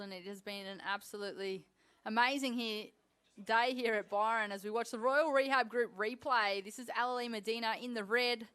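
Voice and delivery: normal talking voice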